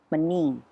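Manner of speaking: manin, the final O dropped; Azorean pronunciation. Shown as a demonstration. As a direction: say manin, cutting off the last vowel. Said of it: "we don't pronounce our O's".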